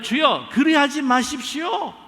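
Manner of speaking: high, loud, slow pleading protest; Peter's desperate loud objection; protesting plea. Retold or imitated. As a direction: beg him to stop, voice rising hard. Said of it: "항변하여".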